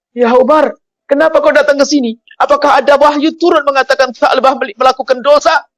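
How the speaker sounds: high, urgent questioning; startled, alarmed. Acted. Boy asks Umar if his sin was revealed, alarmed. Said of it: "Kaget dia".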